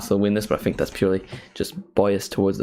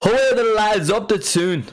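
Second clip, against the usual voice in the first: high, loud hammed-up Geordie cry; rally the fans in a put-on Geordie voice; Geordie accent attempt; 12 semitones higher, 3 dB louder than usual. Shown as a demonstration. Streamer cheering on Newcastle, high Geordie attempt. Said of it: "I can't do the voice".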